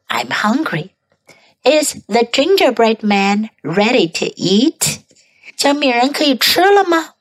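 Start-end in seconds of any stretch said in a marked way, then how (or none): 0.1-0.9 hushed whisper. Shown as a demonstration. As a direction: whisper it softly. Whispered practice take.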